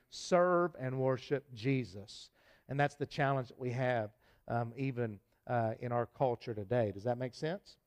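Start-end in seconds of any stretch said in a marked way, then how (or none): 0.1-2.3 slow emphatic charge. Real, not acted. Preacher's closing exhortation.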